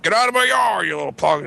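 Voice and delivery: high, mock-outraged holler; angry neighbor. Imitating man chasing kids off.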